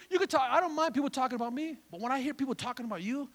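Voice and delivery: ordinary talk, nothing marked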